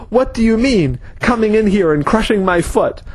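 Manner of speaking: high-pitched angry yell at the intruder; a man with a crushed foot yelling at Wayne, angry and high. A narrator's reading. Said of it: "the man was yelling at Wayne".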